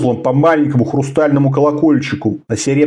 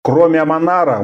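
ordinary delivery

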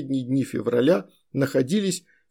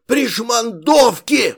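high, slow drawn-out yell; shouting; 8 semitones higher, 5 dB louder, much slower than usual. A narrator's reading. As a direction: stretch out the curse, shouting. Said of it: "Снова закричал". (протяжный злой крик)

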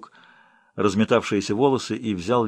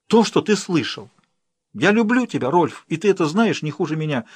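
high, raised impassioned confession; passionate avowal; 6 semitones higher than usual. Acted. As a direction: declare it with defiant passion. Emma repeats her confession.

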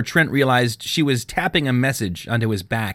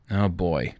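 low, softer mumble to himself; mumbled; 4 semitones lower, 6 dB quieter than usual. A narrator's reading. Trenton dreading Barton's summons, low mumble. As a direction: grumble it under your breath. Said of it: "Trenton mumbled".

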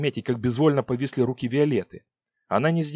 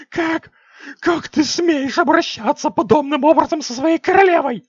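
indignant shriek; 8 dB louder than usual. Acted. Queen defiant and outraged.

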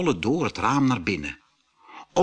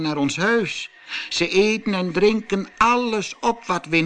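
farmer's wife; 7 semitones higher, 3 dB louder than usual. A narrator's reading. Farmer's wife complains about trolls.

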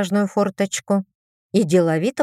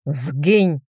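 низкий резкий шик; прогнать одним словом, низко и зло; шикнуть; 4 semitones lower than usual. A narrator's reading. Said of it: "шикнул он на сержанта". (низкий злой шик)

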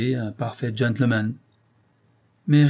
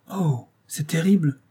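higher-pitched, dismayed gasp of words; acted horror, voice raised in pitch; horrified exclamation; 6 semitones higher than usual. A narrator's reading. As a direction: react with dread to awful news. Heroine horrified by rabies news.

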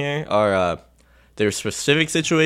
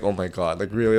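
exasperated; 6 semitones lower, 5 dB quieter than usual. Retold or imitated. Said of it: "So he's just like".

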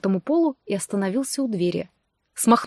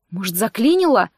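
usual speaking voice

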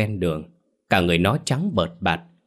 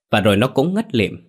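ordinary delivery

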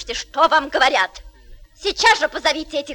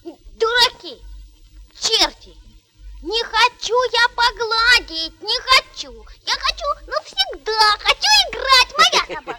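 put-on child's tantrum; 4 semitones higher than usual.

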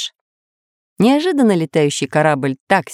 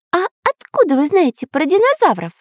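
high-pitched suspicious question; 13 semitones higher than usual. A narrator's reading. Karandash questions the pirates about dinosaurs, high and suspicious. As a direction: probe them in a high, wary little voice. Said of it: "подозрительно спросил Карандаш".

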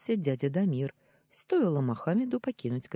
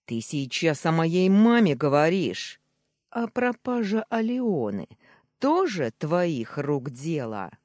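loud, raised, outraged demand; furious roar; 3 semitones higher, 6 dB louder than usual. A narrator's reading. Nephew defending his mother, furious roar. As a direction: confront him in rising fury. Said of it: "взревел я".

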